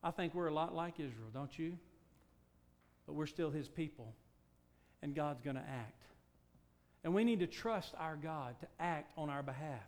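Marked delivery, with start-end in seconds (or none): none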